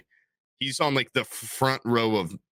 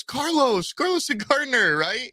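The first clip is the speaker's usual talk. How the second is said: much higher, calling out "Carlos" eagerly; mistaken fan calling; 13 semitones higher, slower than usual. Retold or imitated. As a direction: shout the wrong name with eager confidence. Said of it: "someone sees Peanut and goes".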